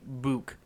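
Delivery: "book" with a long oo; Rock's "book". Retold or imitated. Friend asking how Adam says it, long-oo "book". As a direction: say "book" to rhyme with spook. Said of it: "Do you say book like Rock does".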